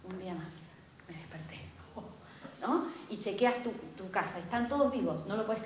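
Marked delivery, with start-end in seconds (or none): 0.0-1.6 awed whisper. Retold or imitated. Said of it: "cada mañana es".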